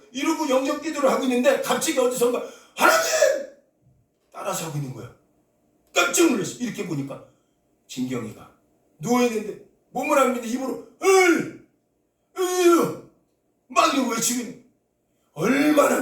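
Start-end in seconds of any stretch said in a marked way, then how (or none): 2.8-3.5 a piercing high cry out of nowhere; dying girl suddenly crying out to God, piercing high. Retold or imitated. 11.0-13.0 high strained wordless cries; strained high cry. Retold or imitated. Girl crying out wordlessly.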